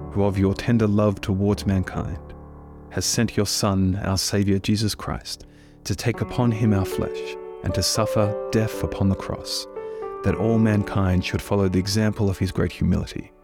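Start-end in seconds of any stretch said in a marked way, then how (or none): none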